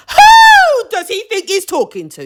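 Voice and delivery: high, loud indignant shout; outraged shout. Real, not acted. To viewers about the rude boyfriend, a high outraged shout. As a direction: yell your outrage at the TV.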